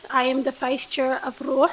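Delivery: ordinary talk, nothing marked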